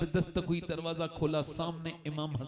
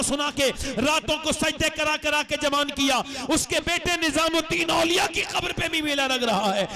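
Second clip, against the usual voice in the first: loud, higher preaching voice, every line pushed; preaching at full force; 6 semitones higher, 7 dB louder than usual. Acted. Preacher urging the listeners to see the saints' graves, at full force. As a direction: rouse the crowd with a ringing sermon. Loud sermon opening to congregation.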